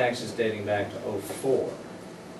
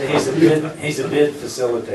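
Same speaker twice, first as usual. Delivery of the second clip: laughing; 4 semitones higher, 8 dB louder than usual. Real, not acted. Joking about the auctioneer voice.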